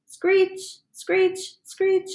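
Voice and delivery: high squealing screech sound; wheel screech. Retold or imitated. Frog narrator mimics the hamster's squeaky wheel, high screech.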